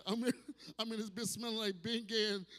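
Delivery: ordinary delivery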